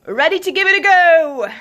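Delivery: put-on loud, high British-accented holler; British accent call. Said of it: "the man called in a British accent".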